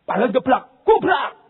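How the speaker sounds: ordinary talk, nothing marked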